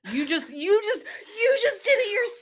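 loud, high-pitched objection, building; excited protest. Real, not acted. Co-host catching a flaw in the idea, excited protest.

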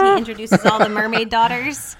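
ordinary delivery

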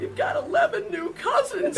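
very high, drawn-out reveal; re-enacted squealed surprise; excited announcement. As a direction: break big news with glee. Announcing big news to kids.

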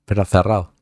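usual speaking voice

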